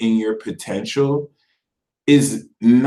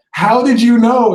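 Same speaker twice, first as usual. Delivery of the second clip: higher and louder, astonished; amazed exclamation; 8 semitones higher, 7 dB louder than usual. Retold or imitated. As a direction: blurt it out in amazement. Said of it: "And it's like".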